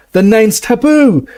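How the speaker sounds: high, loud slow bellow. Acted. Ron bellowing a warning at Harry and Hermione. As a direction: bellow the warning across the tent. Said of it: "Ron bellowed".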